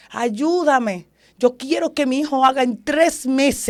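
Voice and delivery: imitating a desperate plea to God; retold crying out. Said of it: "yo empecé a gritar".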